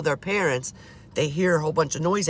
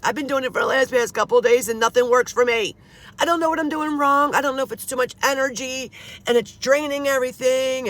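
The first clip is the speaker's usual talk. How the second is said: higher and louder, exasperated rant; real frustration pouring out, raised voice; upset venting; 8 semitones higher, 4 dB louder than usual. Real, not acted. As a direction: pour out the frustration. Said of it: "I never curse unless I'm upset".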